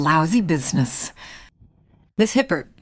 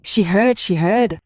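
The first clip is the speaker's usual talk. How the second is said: announcer read; 4 dB louder, slower than usual. Acted. Title announcement.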